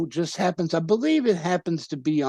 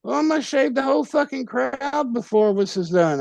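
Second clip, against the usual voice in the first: much higher, half-laughing threat; mock exasperated boast; 10 semitones higher than usual. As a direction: replay the brother's over-the-top vow. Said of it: "And he goes".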